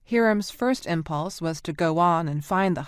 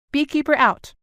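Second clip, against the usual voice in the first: higher, louder brisk radio sign-off; crisp sign-off; 9 semitones higher, 7 dB louder than usual. A narrator's reading. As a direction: clip it off all business. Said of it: "then reverted back to a crisp".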